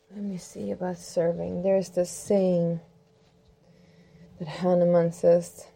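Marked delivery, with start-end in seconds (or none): 0.1-1.6 sleepy murmur. Real, not acted. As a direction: mutter it tiredly while looking something up. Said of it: "way past my bedtime".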